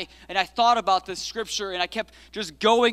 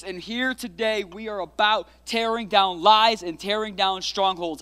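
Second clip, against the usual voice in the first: normal talking voice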